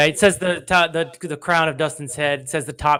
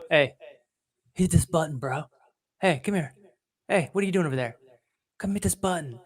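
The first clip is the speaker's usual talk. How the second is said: softer, luring come-here tone; coaxing PayPal voice; 5 dB quieter, faster than usual. Retold or imitated. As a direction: tempt him over quietly like a hustler. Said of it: "And then PayPal goes".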